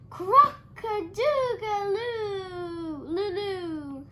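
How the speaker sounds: very slow, high, loud failed crowing; a rabbit trying to crow and failing; botched rooster crow. A narrator's reading. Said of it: "threw back his head and shouted".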